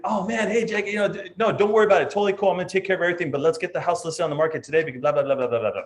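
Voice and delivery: high, fast salesman patter rushing the client; pushy expediter. Retold or imitated. Agent playing a rushing expediter, high and fast. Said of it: "If I'm an expediter".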